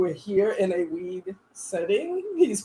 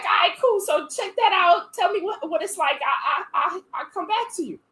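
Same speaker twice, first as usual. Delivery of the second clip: casual guy; 8 semitones higher than usual. Retold or imitated.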